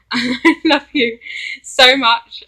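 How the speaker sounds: real giggly outpouring of love; giddy gushing. Real, not acted. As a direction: laugh through an adoring confession. Gushing to idol, laughing.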